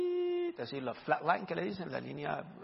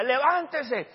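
loud, higher command to get up; commanding shout; 9 semitones higher, 6 dB louder, much slower than usual. Retold or imitated. Preacher urging the brother from his wheelchair, loud command. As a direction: order him out of the chair.

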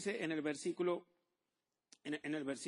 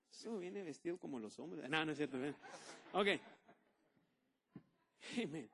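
ordinary talk, nothing marked

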